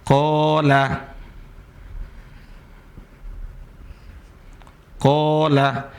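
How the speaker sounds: "Qala" read softly with a long a; slow, soft long-vowel reading; example word. Shown as a demonstration. Teacher reads the example word aloud. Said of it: "Contoh ya".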